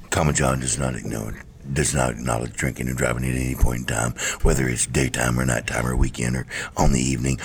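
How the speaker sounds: low flat disclaimer voice; deadpan monotone. Pretend. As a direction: deliver it like a dry legal disclaimer. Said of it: "You have to speak really fast in a very monotone voice".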